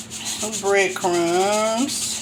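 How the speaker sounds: "breadcrumbs" stretched very slow with a lifted end; drawn-out breadcrumbs. Real, not acted. Grandma to viewers as she adds crumbs: slow, savoring. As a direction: savor each sound of "breadcrumbs", slow and rising.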